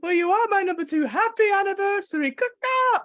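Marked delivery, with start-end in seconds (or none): 2.3-3.0 higher cawing like a crow; crow caws. Acted. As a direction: caw like a crow. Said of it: "random animal sounds".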